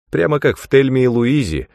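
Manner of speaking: gleeful, lifted voice; amused. A narrator's reading. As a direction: have fun with the comparison. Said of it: "потешалась Пейдж".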